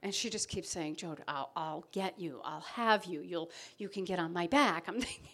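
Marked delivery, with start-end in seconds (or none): 1.0-4.8 soft reassurance. Retold or imitated. Friend reassuring her in the pond.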